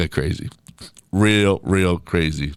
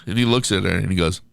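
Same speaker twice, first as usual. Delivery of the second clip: usual speaking voice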